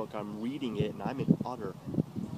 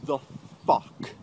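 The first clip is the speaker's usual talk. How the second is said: stunned; slower than usual. Real, not acted. Shocked reaction reading chat.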